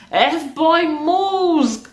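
put-on high, teasing echo of "F-boy moves"; high mocking repeat. Shown as a demonstration.

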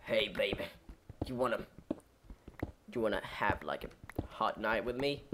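low, soft come-on; flirty dog voice. Acted. Dog propositioning its owner, mock-seductive murmur. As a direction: voice a smooth-talking dog, hushed and low. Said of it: "staring at me like".

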